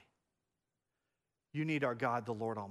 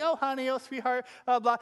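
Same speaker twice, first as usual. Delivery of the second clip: higher-pitched, playful endearments; playful pet names; 7 semitones higher, 6 dB louder than usual. Retold or imitated. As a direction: imitate a wife's playful sweet-talk. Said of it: "those are playful".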